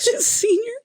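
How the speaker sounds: high, tearful squeak through a laugh. Retold or imitated. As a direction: choke it out high, half laughing, half crying.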